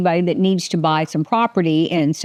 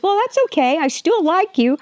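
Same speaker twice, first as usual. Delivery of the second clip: gushing forgiver; 10 semitones higher than usual. Retold or imitated. Someone forgiving her awfulness.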